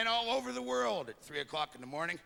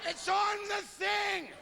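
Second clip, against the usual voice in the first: a couple bellowing back and forth, pitched way up; shouted household exchange; 13 semitones higher, slower than usual. Acted. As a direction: yell slowly across the house. Acted couple calling across the house.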